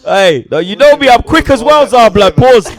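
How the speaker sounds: hammed-up Pumba voice; Pumba impression. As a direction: belt it out as the cartoon warthog. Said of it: "Right into the fucking microphone".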